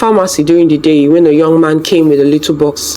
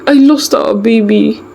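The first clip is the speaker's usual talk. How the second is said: tearful confession; 6 semitones higher than usual. A narrator's reading. Confessing loss crying.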